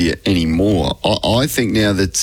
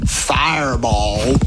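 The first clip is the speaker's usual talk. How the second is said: drawn-out mock yell; 2 semitones higher, much slower than usual. Radio host mimicking a clown heckling from the crowd, mock yell. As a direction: stretch the word out like a heckler calling out. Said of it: "He would have yelled out".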